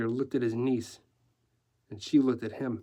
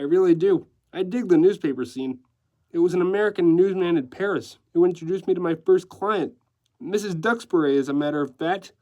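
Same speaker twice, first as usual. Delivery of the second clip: animated, raised-pitch decorator voice; breezy decorator; 5 semitones higher, 5 dB louder than usual. Acted. Decorator telling newsman about his first client, breezy. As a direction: play a chatty, showy decorator. Decorator's lines in narrated story.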